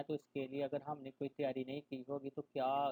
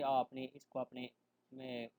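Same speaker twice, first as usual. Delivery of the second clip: usual speaking voice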